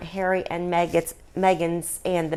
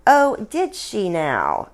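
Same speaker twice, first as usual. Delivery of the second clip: slow intrigued query; slower than usual. Acted. Queen intrigued by gossip.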